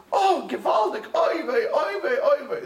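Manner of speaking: high, hammed-up exclamations; gushing girlfriend response. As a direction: imitate an over-the-top girlfriend reacting. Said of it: "responses that your girlfriends do".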